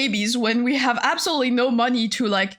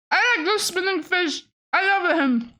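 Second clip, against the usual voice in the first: cute fish voice; 7 semitones higher than usual. Pretend. Voicing the fish reply.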